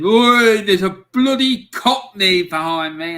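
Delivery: loud, high hostile shout across the pub; mock pub shout. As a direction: bellow it like a rowdy local spotting an outsider. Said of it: "who was in the pub said".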